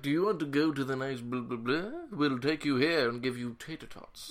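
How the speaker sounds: hammed-up snooty corporate host; mock lawyer voice. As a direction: put on a silly pitch to woo a client. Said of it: "What is that voice?".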